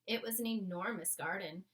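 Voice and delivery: ordinary delivery, nothing marked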